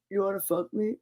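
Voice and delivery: quiet, low creepy line; a hint of menacing villain; understated creepy murmur. Quoting Lecter.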